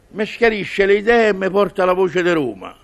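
usual speaking voice

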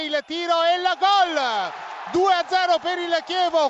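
loud goal shout; excited goal call. Real, not acted. Goal call in live commentary.

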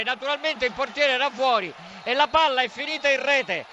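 high, urgent goal call in disbelief; astonished live call of a freak goal; breathless italian goal commentary. Real, not acted.

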